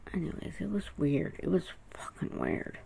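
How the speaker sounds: soft, hushed aside; whispering. Real, not acted. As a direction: drop into a whisper, still unsettled.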